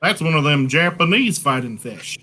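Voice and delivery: mock Southern country talk; really Southern accent. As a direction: lay on a thick Southern accent. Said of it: "if it's really Southern".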